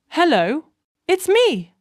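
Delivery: fighting tears, voice cracks; voice cracking. Acted. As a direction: voice breaking on a high note. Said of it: "and my voice cracks".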